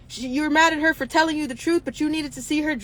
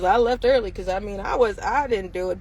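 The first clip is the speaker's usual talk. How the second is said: mock fumbling excuse; 6 semitones lower than usual. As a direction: mimic a flustered guilty bystander. Mocking bystanders' excuse.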